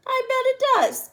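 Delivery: high, proud grandpa reply; proud grandpa, pleased with his pie; proudly. A narrator's reading. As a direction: say it like he's bragging a little. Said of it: "Grandpa says proudly".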